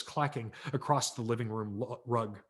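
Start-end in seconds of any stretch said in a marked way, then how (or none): none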